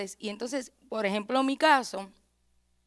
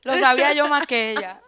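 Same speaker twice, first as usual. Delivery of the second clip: louder emphatic repeat; 3 semitones higher, 9 dB louder than usual. Real, not acted. Host teasing the pastor that he knew first, louder.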